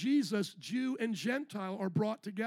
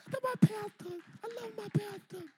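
much higher, squeaky churchgoer voice; mock congregant; 12 semitones higher than usual. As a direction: play a gushing fan in the pews. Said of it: "You don't have to clap".

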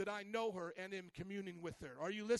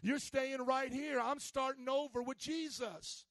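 raised, forceful preacher voice; rousing pulpit declaration; fired-up preaching; 5 semitones higher, 5 dB louder than usual. Real, not acted. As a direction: preach it high and loud, drawing out the end.

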